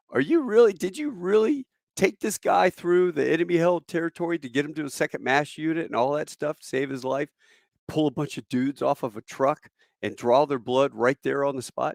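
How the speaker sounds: high-pitched, amazed lieutenant; acted-out disbelief, starting high and easing down; incredulous questioning. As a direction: question it like you can't believe it.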